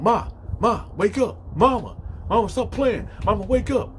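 high, urgent retold calling; trying to wake her. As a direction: act out a son rousing his mother. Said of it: "he trying to wake her up".

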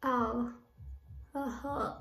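talking with tongue pinched out; speaking around fingers on tongue; fingers holding tongue. Real, not acted. Seen 2: Fingers pinch her stuck-out tongue throughout; hand leaves by +2.9.

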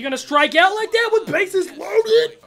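slow, high, loud mock jeer; slow teasing yell; taunting shout. Real, not acted. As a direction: rub it in on the batter. Taunting the batter.

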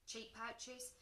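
ordinary delivery, nothing marked

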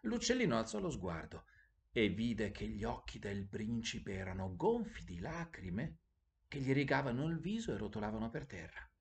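plain reading voice

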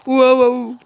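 loud terrified screams; scared horror game streamer. Real, not acted. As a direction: scream as it grabs you. Caught by monster.

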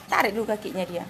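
ordinary talk

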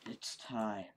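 quiet whispered menace; unhinged villain. Acted. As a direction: plot murder in a whisper.

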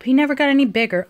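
ordinary delivery

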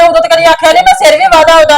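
usual speaking voice

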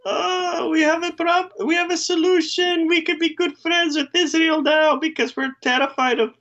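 very high put-on Middle Eastern accent; squeaky Middle Eastern accent, drifting Indian. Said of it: "I wanna apologize for my Middle Eastern accent".

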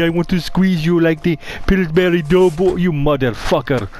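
usual speaking voice

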